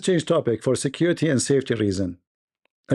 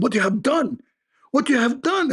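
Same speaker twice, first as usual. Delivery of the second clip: much higher, building alarmed outcry; a hammed-up panicked friend, rising each time; mock angry friend; 10 semitones higher than usual. Friend on the phone to the comedian, building mock outrage.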